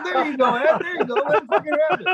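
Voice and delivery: high-pitched laughing encouragement; gleeful cheering on. Retold or imitated. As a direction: egg him on through laughter. Egging on the bandmate, laughing.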